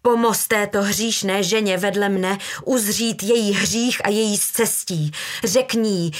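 forceful declaimed prayer; mother's fervent, accusing prayer; declaiming. A narrator's reading. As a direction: preach the plea aloud, line upon line. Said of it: "deklamovala matka".

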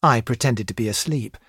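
usual speaking voice

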